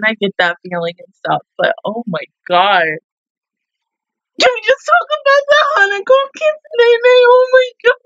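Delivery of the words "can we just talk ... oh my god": very high fangirl squeal; high, loud gushing fan; excited squeal. Real, not acted. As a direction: shriek with delight at the kiss. Squealing over the kiss.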